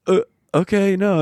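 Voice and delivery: high baffled "Uh, okay, no"; taken aback. Retold or imitated. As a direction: recoil in disbelief. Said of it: "I'm like".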